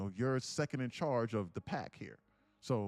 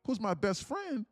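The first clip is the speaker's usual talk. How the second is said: puzzled wildebeest; 6 semitones higher than usual. Acted. Wildebeest wonders about missing friend.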